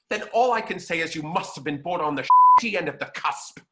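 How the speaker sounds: loud, scornful mock put-down; an acted star's loud nasty retort; cutting diva insult. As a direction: deliver the insult with an imitated sneer. Betty Davis insults the mother.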